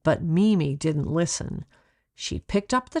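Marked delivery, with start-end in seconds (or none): none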